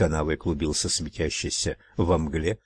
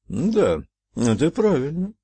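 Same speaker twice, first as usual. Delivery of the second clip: thoughtful; 8 semitones higher than usual. A narrator's reading. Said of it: "задумчиво произнёс синий фарк".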